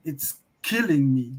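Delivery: normal talking voice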